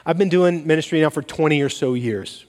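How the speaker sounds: ordinary delivery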